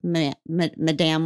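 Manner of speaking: ordinary talk, nothing marked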